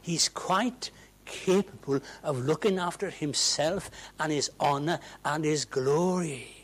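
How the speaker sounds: normal talking voice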